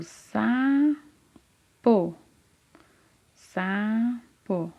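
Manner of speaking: SAPO very slowly, SA held long, then PO; split into syllables. Shown as a demonstration. Teacher showing a child the first syllable, "sapo" drawn out by syllables. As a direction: stretch "sapo" into two separate syllables, sa... po. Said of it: "eu falo a sílaba sa quando eu vou falar sapo".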